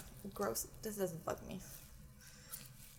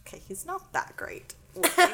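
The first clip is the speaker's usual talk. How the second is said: unimpressed; 8 semitones higher than usual. Retold or imitated. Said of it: "I'm like".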